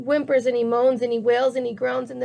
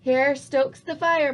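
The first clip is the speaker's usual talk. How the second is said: ordinary delivery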